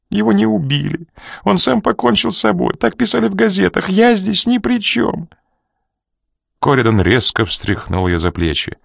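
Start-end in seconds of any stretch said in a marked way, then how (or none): 0.1-5.3 высоко и надрывно, сквозь слёзы; в слезах оправдываться; плача. A narrator's reading. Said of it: "она начала плакать". (Рита плача отрицает убийство)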